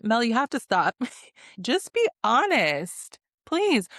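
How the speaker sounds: playful fed-up appeal; exasperated plea. Real, not acted. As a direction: beg her to come clean, climbing on the last word. Begging Mel to admit it.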